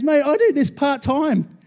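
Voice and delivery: slow, high, laughing mock whine; laughing soldier. Preacher voicing a scared part-time soldier, laughing. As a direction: plead through laughter like a reluctant part-timer. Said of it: "and he goes".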